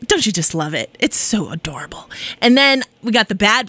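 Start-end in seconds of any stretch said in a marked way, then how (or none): 0.1-2.1 whispered, adoring aside; hushed gush. Real, not acted. DJ cooing over a sweet song in a whisper.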